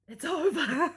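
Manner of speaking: high, loud laugh through the words; relieved mother laughing that it's finally over; laughing relief. Real, not acted. Relief the birth is done.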